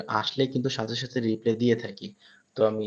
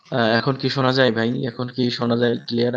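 louder, asking if the stream is audible; louder call to live viewers asking if the sound is clear; louder sound check; 7 dB louder than usual. Real, not acted. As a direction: raise the voice to check they can hear you. Checking whether listeners hear sound.

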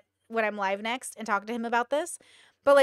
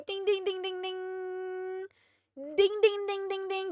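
high sing-song ding ding; mock bell chime; 7 semitones higher, 5 dB quieter than usual. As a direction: chime like a quiz show bell. Mock game-show bell.

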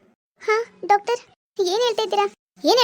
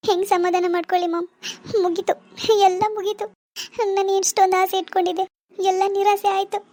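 put-on higher, tearful despairing young wife; tearful daughter; 4 semitones higher than usual. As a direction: complain to mom through tears. Daughter inconsolable. (ಅಳುವ ಮಗಳು)

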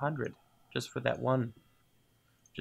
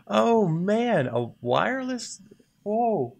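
loud, high excitement; amazed; 7 semitones higher, 7 dB louder, slower than usual. Real, not acted. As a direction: exclaim over an incredible find. Delighted by the find.

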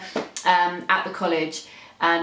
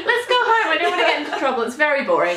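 high, louder pleading with a laugh; worried Pan; 8 semitones higher, 4 dB louder, much faster than usual. Pretend. Co-host joins voicing the timid daemon, laughing plea. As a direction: beg to leave, anxious. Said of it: "I am like Pan".

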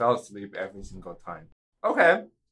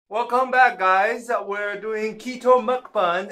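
loud, high welcome back; excited greeting; 10 semitones higher, 8 dB louder, slower than usual. Real, not acted. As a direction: greet the viewers with energy. Vlog greeting to viewers.

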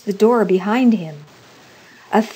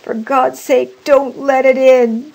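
loud, raised, pleading cry; fearful cry; 5 semitones higher, 8 dB louder than usual. A narrator's reading. Old man begging wife not to open the door, fearful cry. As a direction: plead loudly in dread. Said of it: "cried the old man, trembling".

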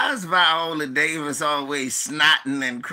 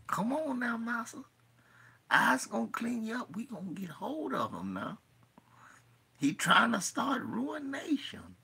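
higher hushed urging whisper; soft whispered coaxing; 7 semitones higher, 9 dB quieter than usual. Retold or imitated. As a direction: coax the master in a whisper. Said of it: "That was Apollo Creed".

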